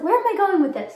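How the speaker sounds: high, loud puzzled outburst; confused exclaim. Retold or imitated. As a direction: blurt it out, lost and baffled. Retold moment of confusion.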